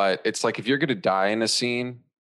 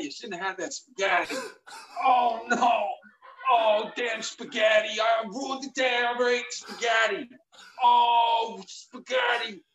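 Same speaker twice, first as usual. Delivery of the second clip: much higher, wailing mock death throes with cries and shouts; hammed-up dying anguish; 12 semitones higher than usual. Reprising the spaghetti heart attack for a friend, hammed-up wailing. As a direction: ham up a death scene, wailing over the spaghetti. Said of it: "I'm so glad you took it as long as you took it".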